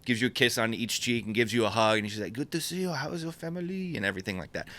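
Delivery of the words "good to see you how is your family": high, soft mock French greeting; awful French accent. Hugging a friend hello, in awful French accent. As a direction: gush softly in a bad French accent. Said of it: "Awful French accent".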